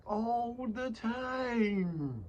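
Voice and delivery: hugely drawn-out, high, falling at the end; shaking the chin. Real, not acted. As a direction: stretch each word way out, pitched high. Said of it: "I shake my chin like that".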